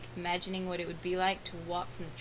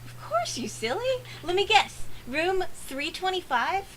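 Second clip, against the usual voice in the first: much higher, loud, playful and chirpy; bubbly girl voice; 11 semitones higher, 6 dB louder than usual. Acted. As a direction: tease like a hyper cheerful roommate. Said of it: "how I'm going to voice her".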